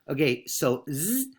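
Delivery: ordinary talk, nothing marked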